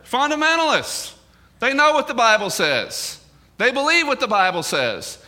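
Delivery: high, emphatic pulpit proclamation; impassioned preaching. Acted. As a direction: hammer each point home from the pulpit. Preacher raises intensity.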